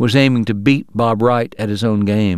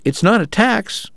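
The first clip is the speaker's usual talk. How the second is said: higher, slow, innocent protest; innocently; 9 semitones higher, slower than usual. Acted. Bob to the poker players, mock innocent and slow. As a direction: play it wide-eyed and blameless. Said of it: "Bob said innocently".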